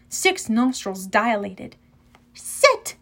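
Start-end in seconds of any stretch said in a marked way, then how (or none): 2.3-3.0 one high, sharp called order; called-out command. A narrator's reading. Annabeth orders Cerberus to sit, calling out. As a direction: call it high, like training a dog. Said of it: "Annabeth called again".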